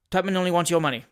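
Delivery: ordinary delivery, nothing marked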